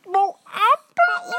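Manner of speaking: high character voice sniffing; sniffing the air. Acted. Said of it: "Tiba-tiba gini, "Hmm".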